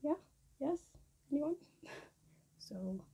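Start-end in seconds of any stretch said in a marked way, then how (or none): 0.0-1.6 high, loud teasing mother; mock mom catching her out. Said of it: "she'll be like".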